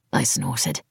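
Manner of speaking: usual speaking voice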